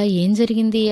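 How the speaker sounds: usual speaking voice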